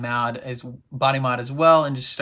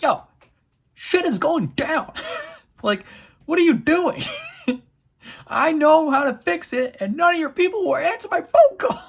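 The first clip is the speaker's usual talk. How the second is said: much higher and louder, squeaky panicked caller; mock frantic Avasarala; 5 dB louder than usual. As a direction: squeal it out like a panicked boss on the phone. Avasarala frantic on phone to Gao.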